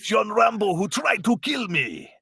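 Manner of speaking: put-on laughing, shouted Russian accent, pitched high; hammed-up Russian accent through laughter; bad Russian accent. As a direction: yell it as a cartoon Russian general, cracking up. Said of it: "about as best as my Russian accent can get".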